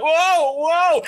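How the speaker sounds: high, loud whoas; mock alarm. Imitating flag bearers chasing their flag, mock alarm. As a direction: call out as if losing control. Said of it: "They're like".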